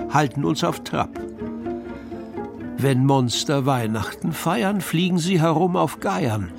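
ordinary delivery

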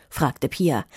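usual speaking voice

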